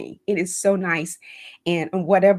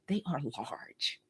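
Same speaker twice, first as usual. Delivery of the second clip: slow, hushed whisper; whispered secret; 4 semitones lower, 14 dB quieter than usual. Real, not acted. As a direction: let it slip out in a whisper, as if confiding. Confiding about visions from God.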